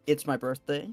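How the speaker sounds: usual speaking voice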